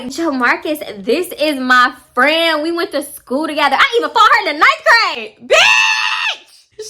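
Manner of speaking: drawn-out intro climbing to a high shriek; mock introduction. Friend introducing her to Jamarcus, hammed-up and escalating.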